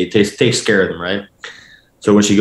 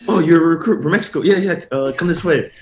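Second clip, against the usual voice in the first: higher, fast, eager staffer voice; suddenly helpful team staffer, pitched up and quick; 8 semitones higher than usual. Retold or imitated. Staffer welcomes the Mexico recruit, eager and higher. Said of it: "and the guy goes".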